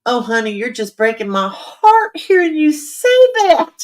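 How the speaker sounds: put-on sweet Southern accent, fussing over a friend. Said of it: "a Southern accent, accent hers".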